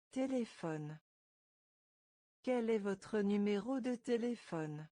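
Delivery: ordinary delivery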